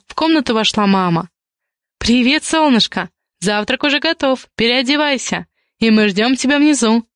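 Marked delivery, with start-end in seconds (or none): none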